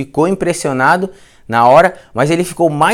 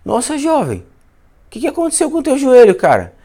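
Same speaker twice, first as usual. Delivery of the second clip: high mock-shocked voice, much higher; teasing friend; 9 semitones higher than usual. Friend mocking the patient's swollen knee, high mock shock. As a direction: ham up a friend's fake alarm. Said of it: "o Macarrão olhou e falou".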